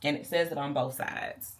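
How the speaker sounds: ordinary delivery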